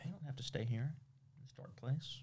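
soft mock-soothing whisper. Host mock-comforting upset friend, hushed whisper. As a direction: whisper like a therapist.

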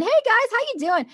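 high, bright-eyed chirpy hello; bubbly peppy greeting. Retold or imitated. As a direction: pop in like the office ray of sunshine. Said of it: "bubbly little peppy little shit".

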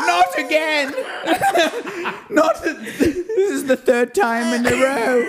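high, slow whimpering elf; whiny elf. Pretend. Host voicing the abused elf complaining, high and slow. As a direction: whine it like a beaten little elf.

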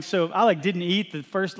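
ordinary talk, nothing marked

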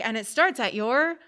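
high, loud, sing-song lesson voice; teacher explaining. Retold or imitated. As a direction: spell it out like a preschool teacher. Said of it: "they're like".

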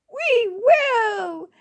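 put-on high, drawn-out girlish cheer; excited cry. A narrator's reading. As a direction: call it out like eager girls answering together. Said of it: "cried the four girls at once".

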